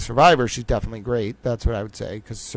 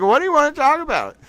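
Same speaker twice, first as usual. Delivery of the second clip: high trolling question; 11 semitones higher, faster than usual. Pretend. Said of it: "I'm like".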